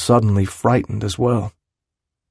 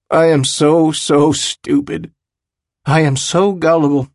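self-reproach; 6 semitones higher, 4 dB louder than usual. A narrator's reading. Willem thinks it while yelling at his friend, self-reproach. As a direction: berate yourself with growing force. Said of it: "he thinks, even as he yells".

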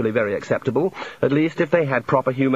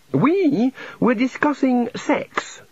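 high, slow and eager, a touch of glee; enthusiastic; 12 semitones higher, much slower than usual. A narrator's reading. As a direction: announce it like wonderful news. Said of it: "said the god enthusiastically".

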